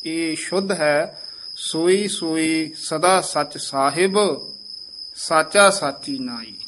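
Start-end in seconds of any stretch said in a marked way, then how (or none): 1.6-6.5 slow, with a break after "Sahib"; pause placement demo. Shown as a demonstration. Teacher showing the seminary's phrasing, measured pause. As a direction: hold "Sahib", pause, then "ਸਾਚਾ ਸਾਚੀ ਨਾਈ". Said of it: "ਇਸ ਦਾ ਵਿਸਰਾਮ ਇਸ ਤਰ੍ਹਾਂ ਲਵਾਇਆ ਜਾਂਦਾ ਹੈ".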